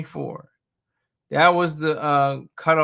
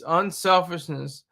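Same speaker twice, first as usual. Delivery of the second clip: normal talking voice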